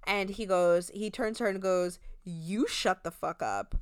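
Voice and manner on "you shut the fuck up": lower, slow mock tough-guy retort; bass in his voice. Chance telling New York to shut up, in a lower, slow bass voice. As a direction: drop low and slow to snap back. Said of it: "With, like, bass in his voice".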